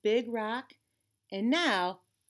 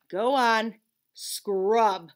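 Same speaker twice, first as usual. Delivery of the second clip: louder firm command; stern order; 7 dB louder, much slower than usual. A narrator's reading. Conscience ordering Leon to wash the pants, loud and stern. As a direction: order him to scrub.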